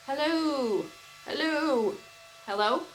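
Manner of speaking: loud, high hellos into a fast fan; fan alien voice. Pretend. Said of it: "still don't sound like an alien".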